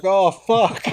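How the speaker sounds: mock-dismayed exclamation; retold dread. Retold dread of the other side.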